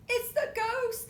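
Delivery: high, loud frightened cry; high loud scared yell; scared scream. Acted. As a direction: shriek it in mock fright. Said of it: "they all scream".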